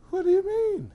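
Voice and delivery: high, loud mock whine; hurt child. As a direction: wail it like a crushed little girl. Devalued child's hurt reply, acted.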